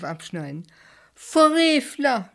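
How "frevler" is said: high, loud outraged scream. A narrator's reading. Julius screams as Bert cuts the rose, outraged scream. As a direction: scream at the blasphemer. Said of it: "schrie Julius".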